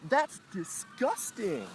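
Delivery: high, drawn-out mock disgust; put-on high voice of disapproval; mimicked voice. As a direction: imitate a disgusted woman, slow and high. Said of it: "I hear a voice".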